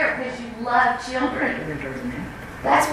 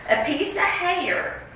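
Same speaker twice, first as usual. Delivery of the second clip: ordinary delivery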